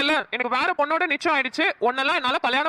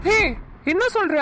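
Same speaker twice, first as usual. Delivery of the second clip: shocked; 6 semitones higher than usual. Acted. Shocked by marriage confession.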